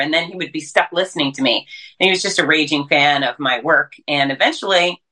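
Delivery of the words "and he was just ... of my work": ordinary talk, nothing marked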